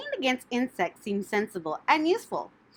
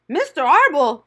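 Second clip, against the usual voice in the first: sobbing; 6 semitones higher, 9 dB louder than usual. A narrator's reading. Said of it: "sobbed Wilbur".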